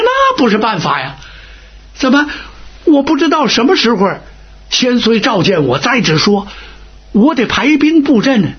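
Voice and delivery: high-pitched, acted indignation of an old commander; indignant protest. Old commander indignantly rejects hiding.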